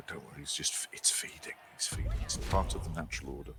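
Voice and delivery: hushed wildlife documentary narrator; nature documentary whisper. Retold or imitated. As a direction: whisper like a wildlife presenter watching an animal. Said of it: "get David Attenborough on to, uh, describe it".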